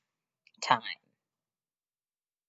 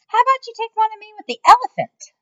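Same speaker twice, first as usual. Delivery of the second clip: much higher, loud, eager; eager boss; 8 dB louder, faster than usual. Acted. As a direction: angle for the photo. Mac voiced.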